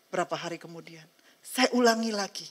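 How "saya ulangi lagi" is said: ordinary delivery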